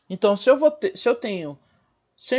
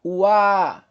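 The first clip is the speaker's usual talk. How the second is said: "O A" run together, the A held long; louder, stretched "A" in "O A"; sustained A; 5 dB louder than usual. Shown as a demonstration. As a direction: glide from "O" into a long open "A". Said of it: "Observa como se sustenta o A".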